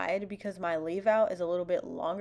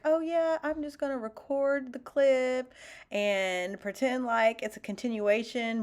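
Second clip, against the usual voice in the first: higher, breezy mock self-quote; 6 semitones higher, 3 dB louder than usual. Vlogger retells her plan to fake continuity, breezily. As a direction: act out your own carefree plan.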